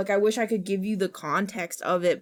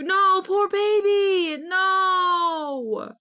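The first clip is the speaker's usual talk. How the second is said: cooing sympathy; 11 semitones higher, 4 dB louder, much slower than usual. Real, not acted. Sympathizing with trypophobic viewer.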